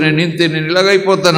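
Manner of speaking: despairing self-talk. Acted. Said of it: "అనుకుంటాం".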